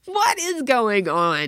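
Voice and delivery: loud, high, drawn-out disbelief; mock outrage. Mom reacting to daughter's story, loud incredulity. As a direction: wail it in exaggerated bewilderment. Mom's retold shock.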